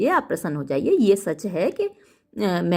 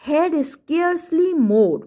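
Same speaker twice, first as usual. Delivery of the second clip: dramatic reading; 8 semitones higher, much slower than usual. Shown as a demonstration. Reading Macbeth line slowly.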